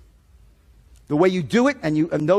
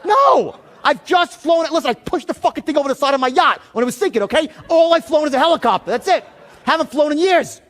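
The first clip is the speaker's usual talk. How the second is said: high, loud exasperated yell at the agent; agitated yelling; 8 semitones higher, 4 dB louder than usual. Retold or imitated. Caller shouting at the insurance agent in angry disbelief. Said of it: "I'm starting to get a little agitated".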